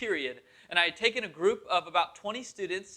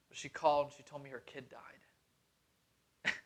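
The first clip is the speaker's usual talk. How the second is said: shaken, subdued; 4 semitones lower, 7 dB quieter, slower than usual. Retold or imitated. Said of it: "visibly shaken to my core".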